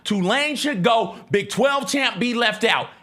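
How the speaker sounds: mock rant at the conference. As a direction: fire up and rant at the whole conference. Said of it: "Clip that shit".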